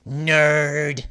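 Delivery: ordinary delivery, nothing marked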